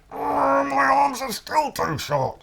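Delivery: very high, excited then deflated; high crocodile trying to fly; crocodile character voice. Acted. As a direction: flap and fail in a high voice. Crocodile climbing.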